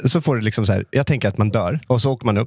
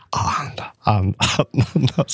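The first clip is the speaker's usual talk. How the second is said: whisper. Retold or imitated. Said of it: "Så jag började viska".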